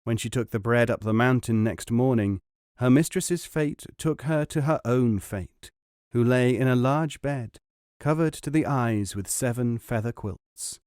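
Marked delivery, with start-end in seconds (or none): none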